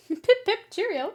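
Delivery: high, loud put-on British farewell; mock British accent. Streamer waving off a viewer, mock British accent. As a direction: chirp it in a jokey British accent.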